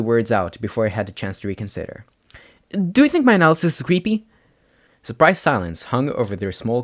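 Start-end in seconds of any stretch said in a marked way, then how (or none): 2.7-4.3 anxious blurt. A narrator's reading. Izuku blurts anxious question.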